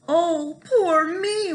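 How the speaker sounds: high, loud self-pitying wail. Acted. Lazy Jamie wails that he will starve, hammed-up wailing. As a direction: cry out in mock despair. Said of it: "wailed Jamie".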